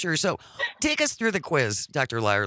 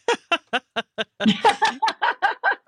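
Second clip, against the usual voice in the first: loud high laugh; 13 semitones higher, 5 dB louder than usual. Real, not acted. Listener text makes host laugh.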